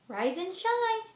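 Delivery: high, slow singsong wake-up; chirpy mom. Acted. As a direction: sing it to wake the child. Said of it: "chirps my mom".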